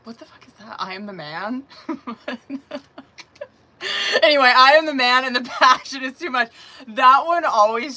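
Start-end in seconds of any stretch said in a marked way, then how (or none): 1.9-8.0 loud, high, laughing through the words; can't stop laughing while reading it out. Real, not acted. Tarot reader laughing loudly reading a card to viewers.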